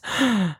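sharp high gasp; mock gasp. Reacting to his own silly insult, a mock gasp. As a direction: gasp as if scandalized.

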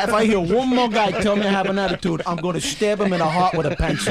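mock Brooklyn menace; tough Brooklyn attitude. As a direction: play the tough Brooklynite, half-laughing. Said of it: "I'll do this".

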